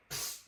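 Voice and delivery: soft, voiceless hiss of psst; whispered psst. Retold or imitated. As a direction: imitate a whisper into someone's ear. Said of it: "went to whisper something".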